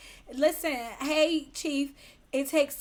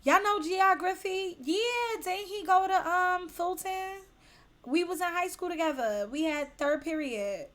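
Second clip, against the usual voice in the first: higher-pitched chatty skit voice; put-on higher voice, casual reminiscing; gossiping about an acquaintance; 5 semitones higher than usual. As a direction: play someone recalling an old classmate. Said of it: "sounds like that's somebody's name".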